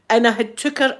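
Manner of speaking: ordinary talk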